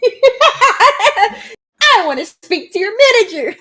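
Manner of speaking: high, slow, laughing Karen whine; entitled customer. Acted. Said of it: "one will be called Karen".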